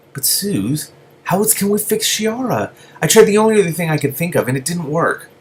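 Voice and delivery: pleading. Acted. Daystar pleading with lizard.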